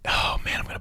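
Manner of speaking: breathy whisper to himself, searching memory; whispered aside. Real, not acted. Guest whispers to himself while trying to recall a quote, whispered. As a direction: whisper it under your breath, half to yourself. Forgetting a quote mid-story.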